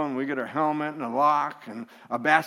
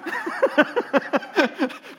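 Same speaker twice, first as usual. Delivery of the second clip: hearty laugh; 10 semitones higher than usual. Real, not acted. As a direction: laugh hard at your own joke. Laughing at himself.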